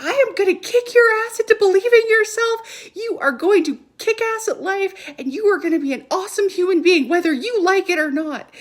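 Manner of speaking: high, loud fired-up mom shouting encouragement; intense motivational yell. Retold or imitated. Mom pumping up her seven-year-old son, a fired-up yell. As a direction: yell belief into him like a pep talk. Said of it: "'Cause I was just like".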